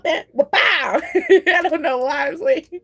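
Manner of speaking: laughing at herself, pitch jumping up. Real, not acted. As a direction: crack up at your own sound effect. Laughing at own silly sound.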